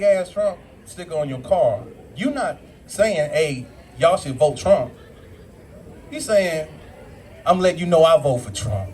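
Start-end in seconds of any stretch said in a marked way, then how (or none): none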